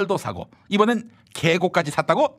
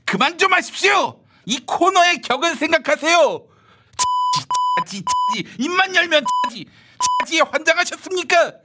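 outraged scolding; 8 semitones higher than usual. Acted. Outraged host scolding partner.

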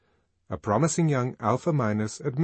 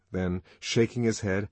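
ordinary delivery

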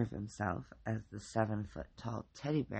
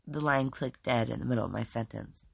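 ordinary delivery

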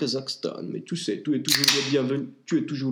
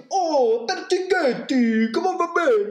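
much higher, louder, slow delighted voice; squeaky pope; 5 dB louder, slower than usual. Acted. The pope greets Pertighetti, very high and delighted. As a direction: play the pope thrilled to see him. Pope answers in acted scene.

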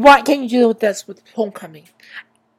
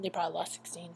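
a hushed whisper under the breath; whispered gossip; whispered aside; 3 semitones lower, 17 dB quieter, faster than usual. Real, not acted. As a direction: let it slip out as a secret whisper. Gossipy aside about ratings.

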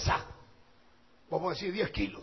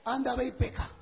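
loud, high, driving sermon shout; preaching at full force; 5 semitones higher, 9 dB louder than usual. Acted. Preacher warns the congregation against sin, full-force preaching shout. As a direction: thunder the warning at the congregation.

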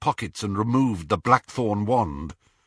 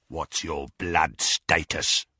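gloating werewolf; 4 semitones lower than usual. Acted. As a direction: savour every word like prey. Greyback interrogates.

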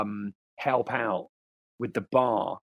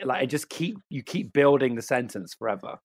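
usual speaking voice

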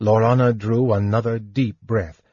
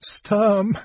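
high, anguished cry of a name; tearful appeal; choked with tears; 10 semitones higher than usual. A narrator's reading. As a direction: call out tearfully, pitched up. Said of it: "Lorana's tears choked her".

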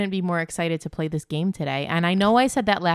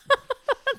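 high laugh. Real, not acted. Laughs at own answers.

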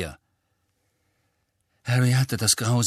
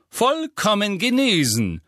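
beaming call; 11 semitones higher, 4 dB louder than usual. A narrator's reading. Mr Weasley entering the kitchen, beaming loudly. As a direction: call it out glowing with joy. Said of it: "rief er strahlend".

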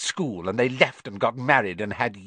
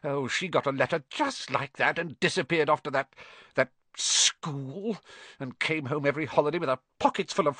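high, bitter tirade building louder; ranting aunt; 6 semitones higher, 4 dB louder than usual. A narrator's reading. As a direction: spit out years of resentment. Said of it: "then went ranting on".

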